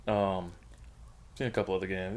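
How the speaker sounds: usual speaking voice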